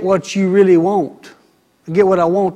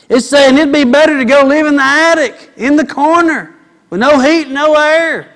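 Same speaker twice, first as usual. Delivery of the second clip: high and loud, building the joke; exclaiming about the attic; excited preaching; 7 semitones higher, 5 dB louder than usual. Acted. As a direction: push the punchline up and out.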